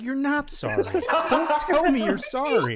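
loud, high, laughing exasperation; exasperated. Retold or imitated. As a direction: scold them, half laughing. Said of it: "I'm like".

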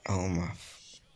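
ordinary talk, nothing marked

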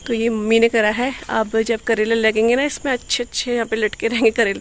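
ordinary delivery

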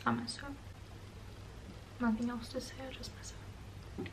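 very soft, almost to yourself; hushed, tender murmur; quiet wistful aside. Real, not acted. Wistful aside on seeing second mom.

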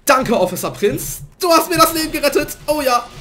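loud, high, overjoyed thanks; excited thanks. Real, not acted. Thanking teammate Officer Prinz, loud and high. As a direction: gush gratitude loudly.

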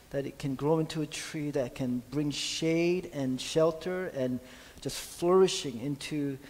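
soft, low, hushed prayer voice; murmured closing prayer; quiet prayer. Acted. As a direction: pray gently, almost to yourself. Pastor closes a prayer.

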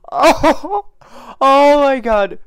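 high, loud overwhelmed cry; real rush of emotion. Real, not acted. Viewer overwhelmed by the post-credit scene, high loud cry.

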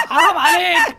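loud protest through laughter; laughing shout. Real, not acted. Friend protesting a scare, shouting through laughter. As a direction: yell it while cracking up.

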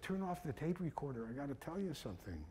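confiding. Retold or imitated. Father asking his son to stop recording, confiding. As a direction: drop the volume to share something privately.

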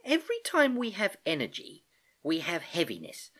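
musing niece. Acted. Niece ponders a new question.

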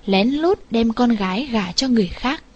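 plain reading voice

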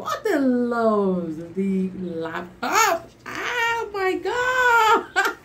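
slow, laughing, voice cracking with joy. Real, not acted. Vlogger overwhelmed unboxing her laptop, laughing near tears.

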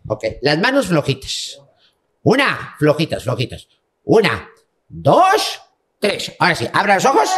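usual speaking voice

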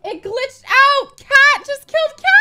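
high, loud stunned cry; shocked yelling. Real, not acted. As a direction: call out the killing in shock. Stunned by in-game killing.

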